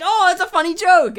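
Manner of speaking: high, loud delighted voice; excited friend. Retold or imitated. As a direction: sound suddenly thrilled. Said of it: "he was like".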